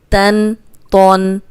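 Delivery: تن drawn out slowly with a nasal n; slow tanween demo; drawn-out tan. Shown as a demonstration. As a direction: stretch تن, ringing the noon sound. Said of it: "یہ موٹا حرف ہے".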